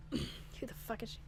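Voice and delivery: ordinary delivery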